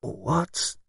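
faint, thick and drowsy. A narrator's reading. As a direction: barely push the words out, thickly. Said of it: "in a faint, thick voice".

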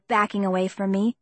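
ordinary delivery, nothing marked

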